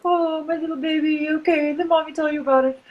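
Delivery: put-on baby the child like a fussing mom; doting mommy. A mother soothing her scared child about monsters, high cooing.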